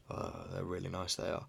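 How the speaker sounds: very soft imitation of grudging praise; under his breath. Dad grudgingly admiring his child's shoes, under his breath. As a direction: drop the volume right down, as if reluctant to be heard. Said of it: "always just saying it under his breath".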